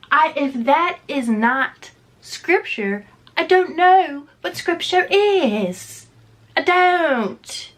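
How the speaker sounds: high, emphatic hint of British accent; accidental British accent. Real, not acted. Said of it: "this British accent came from".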